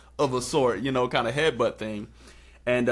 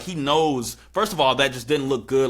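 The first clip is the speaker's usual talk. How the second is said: ordinary delivery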